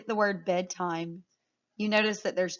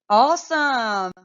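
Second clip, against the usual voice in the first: excited praise; 3 semitones higher, 8 dB louder, slower than usual. Real, not acted. Teacher praising class.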